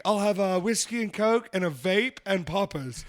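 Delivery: put-on loud, slow, lightly British accent; mock British bar order. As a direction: order grandly with a hint of English accent. Acted bar order in England.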